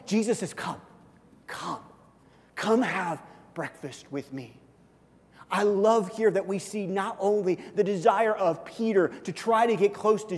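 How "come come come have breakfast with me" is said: soft, unhurried come come come; a soft welcoming call to breakfast; gentle invitation. Retold or imitated. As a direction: coax them in quietly.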